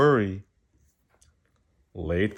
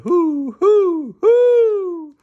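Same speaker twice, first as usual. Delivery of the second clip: even higher, louder repeated whoo, whoo, whoo; owl hoots; 9 dB louder, much slower than usual. Acted. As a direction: hoot whoo, whoo, whoo harder to sound like a real owl. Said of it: "Let me try again".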